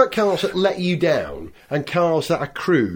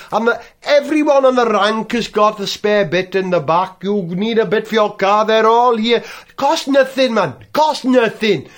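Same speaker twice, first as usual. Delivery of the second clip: put-on loud London cabbie accent; cabbie voice continued; 4 semitones higher, 5 dB louder than usual. Taxi driver boasting about cheap spares, louder cabbie accent.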